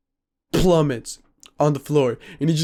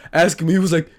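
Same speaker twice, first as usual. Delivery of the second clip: normal talking voice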